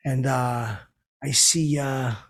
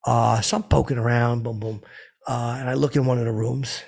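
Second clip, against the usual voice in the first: ordinary talk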